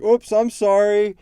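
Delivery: mock Eddie. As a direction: play the villain sheepishly sucking up to his enemies. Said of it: "it's not like Eddie's gonna be like".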